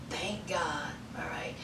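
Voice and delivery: breathy whispered thank God; whispered relief meant only for the viewers; whispered aside. Real, not acted. Relieved aside about her leaving.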